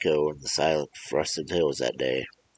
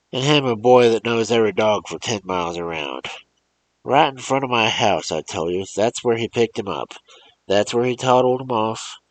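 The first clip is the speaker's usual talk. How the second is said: loud, raised, accusing rant; angry old man; 5 semitones higher, 6 dB louder than usual. Acted. Old man demanding the mother call her boy, angrily loud. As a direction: hammer the mother with blame.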